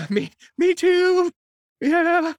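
loud, high, drawn-out wail; slow hammed-up tearful agreement; mock crying. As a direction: blubber along in exaggerated sympathy. Retold crying along with her.

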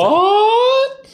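squeaky high shocked what; stunned disbelief. Real, not acted. Shocked reaction.

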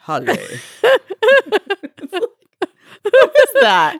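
cracking up mid-impression; laughing imitation. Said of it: "sounds like a butler on a bad".

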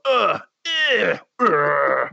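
hammed-up guttural grunting; mock raptor grunts. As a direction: grunt like a caveman talking to raptors. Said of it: "then I just go".

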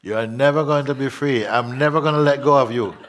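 mock-menacing ghost of the mind, laughing; taunting inner voice. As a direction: voice the clingy mind, gleefully.

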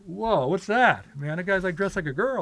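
high-pitched, taken-aback reaction; mock bewilderment. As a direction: imitate bandmates startled by an album cover. Voicing the band seeing the album cover.

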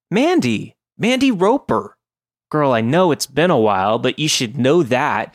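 put-on high, breezy valley girl voice; sing-song girly chatter; chirpy valley girl. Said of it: "a chirpy valley girl".

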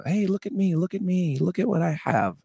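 high, boastful look-at-me calling; show-off bragging. Retold or imitated. A showman begging everyone to look at him, mock bragging. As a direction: flaunt it like a showman craving attention. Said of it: "I was a show person".

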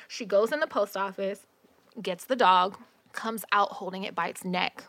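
ordinary talk, nothing marked

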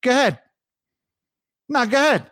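mildly sarcastic go ahead; New York phrase. Retold or imitated. Host quoting a New Yorker at the bagel shop, mild sass. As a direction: say it with a little bite. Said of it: "the first one of those phrases".

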